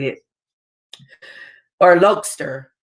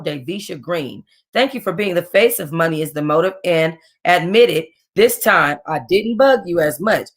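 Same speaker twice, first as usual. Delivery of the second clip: plain reading voice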